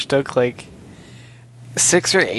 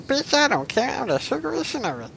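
put-on very high squeaky little kid voice; squeaky bratty kid; 12 semitones higher, 4 dB quieter than usual. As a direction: squeal it like a tiny brat. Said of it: "run around going".

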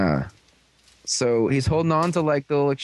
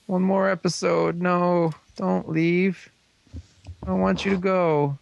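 higher, drawn-out playful whine; hammed-up pleading not to leave; mock sad pleading; 4 semitones higher than usual. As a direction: beg a favorite character to stay. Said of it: "it was all sad".

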